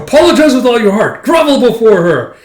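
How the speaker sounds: loud, high, acted command; furious order. Companion forcing him to apologize to Yona, furious. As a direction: order him down in mock fury.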